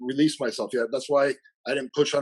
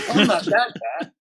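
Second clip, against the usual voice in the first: indignant protest; 6 semitones higher, 4 dB louder than usual. Retold or imitated. Client protests the joke indignantly.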